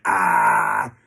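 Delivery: loud low bellow of a raging dad; imitated angry roar. Imitating the furious dad charging at him, roaring. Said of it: "keeps running at you and going".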